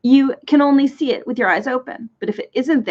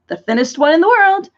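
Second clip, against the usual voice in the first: higher, louder cheerful boast; brightly proud; bright reply; 7 semitones higher, 5 dB louder than usual. A narrator's reading. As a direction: say it brightly, pleased with yourself. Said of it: "he replied brightly".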